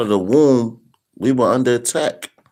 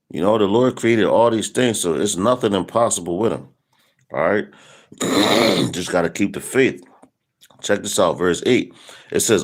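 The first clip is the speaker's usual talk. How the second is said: normal talking voice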